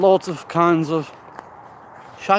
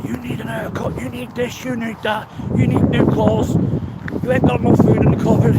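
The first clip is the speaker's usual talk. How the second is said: higher nagging voice building to loud, fast scolding; berating inner critic; 7 semitones higher, 3 dB louder than usual. Retold or imitated. Critical self beating him up, higher, building to loud. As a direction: pile on the demands like a bullying inner voice. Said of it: "the critical self that wants to beat you up and say".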